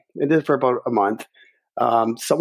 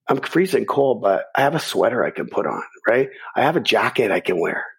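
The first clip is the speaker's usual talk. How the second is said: usual speaking voice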